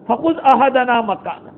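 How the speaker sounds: slow melodic recitation of the verse; slow Quranic recitation. Acted. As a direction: intone it slowly like tilawat.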